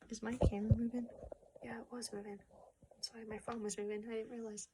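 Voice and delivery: hushed off-script whisper; apologetic hushed whisper; whispered aside. Real, not acted. Aside about camera moving.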